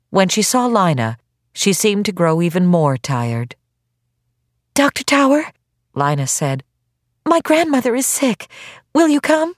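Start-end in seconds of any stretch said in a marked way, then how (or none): 4.7-5.5 put-on high-pitched girl's appeal; girl seeking help; worried young girl. 7.3-9.5 put-on high, pleading girl's voice; girl appealing to a doctor; worried young girl. Girl begs doctor to come.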